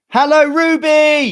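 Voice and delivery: high, loud, drawn-out greeting; calling out. Real, not acted. Host greeting viewer Ruby, calling out. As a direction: sing out a big hello.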